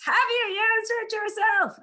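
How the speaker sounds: high, loud shout; shouted all at once by a class; group shout. Shown as a demonstration. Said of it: "So everybody shouts".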